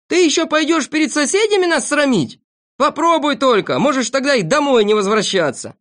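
high, loud scolding; angry shouting. A narrator's reading. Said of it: "закричали тут все". (гневно, с угрозой)

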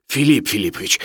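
plain reading voice

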